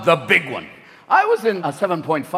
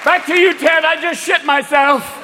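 shaken weatherman; 10 semitones higher, slower than usual. Acted. Weatherman throwing back to Ted in shock, rattled. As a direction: hand back to the studio while badly shaken.

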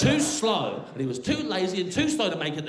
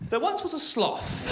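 storybook opening; 9 semitones higher than usual. Acted. Starting a fairy-tale parody.

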